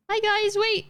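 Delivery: high-pitched call to the group; high, eager hail. Real, not acted. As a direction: call after them in a high voice. Calling out to the mobs.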